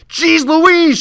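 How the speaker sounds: very high, loud cross shout. Acted. Old woman yelling at the boy over the stove, cross shout. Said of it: "she shouted crossly".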